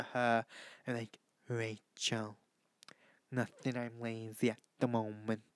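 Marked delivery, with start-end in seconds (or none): 1.5-5.4 very soft, low impression. Vlogger showing off an impression of a singer, low-key mimicry. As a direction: drop the volume right down into an impression. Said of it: "I do a really good [name] impression".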